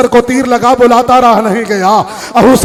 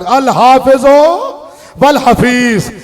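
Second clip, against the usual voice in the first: very slow, fuller-voiced farewell; drawn-out farewell; 3 semitones higher, much slower than usual. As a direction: stretch "हाफिजो" long and strong. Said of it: "जिस तरह भी जाकिर पढ़ते हैं". (धीमी, ज़ोरदार, खिंची हुई अलविदा)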